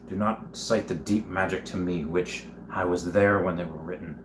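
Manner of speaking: soft, low-key solemn lion voice; Liam Neeson as Aslan. Retold or imitated. Streamer quoting a film lion to claim seniority, hushed solemn impression. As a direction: quote it with hushed, grave authority. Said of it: "like Liam Neeson as Aslan".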